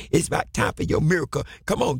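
ordinary talk

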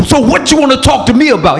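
confronting. Retold or imitated. Preacher retelling confronting the visiting pastor, combative. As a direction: square up to him. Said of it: "So I went off on him".

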